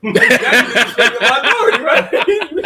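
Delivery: high-pitched, giggly pretend telling-off; laughing mock scolding. Husband imitating himself telling playful wife off, laughing mock scolding. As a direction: scold through your own laughter.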